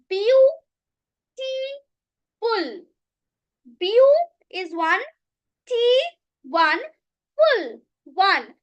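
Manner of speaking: Beautiful split as beau, ti, ful, counting each part; syllable counting. Shown as a demonstration. Teacher counting syllables of beautiful for the class. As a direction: break Beautiful into three beats. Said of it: "there are three syllables in it".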